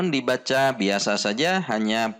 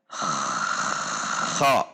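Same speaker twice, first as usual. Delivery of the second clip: "kha" drawn out slowly, lower, pressure before release; slowed kha; 9 semitones lower than usual. Shown as a demonstration. Teacher slowing "kha" to show the pressure at the point. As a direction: hold the pressure, then let "kha" out slow. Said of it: "Kalau dilambatkan".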